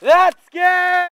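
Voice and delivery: high, loud cheer; excited shout. Real, not acted. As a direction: yell it out in delight. Excited call after winning round.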